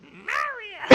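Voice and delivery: high-pitched Toad yelling Mario; Toad impression. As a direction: squeal it like the little mushroom. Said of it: "Do an impression of Toad".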